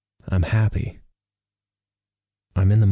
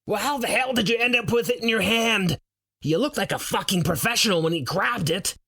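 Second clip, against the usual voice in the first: high-pitched exasperated shout; baffled friend yelling across the grass; shouting back; 13 semitones higher than usual. Acted. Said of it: "Roar shouts back".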